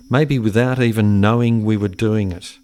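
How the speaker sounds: ordinary talk, nothing marked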